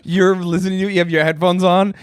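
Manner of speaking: ordinary talk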